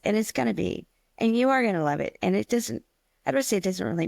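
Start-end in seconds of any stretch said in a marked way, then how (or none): none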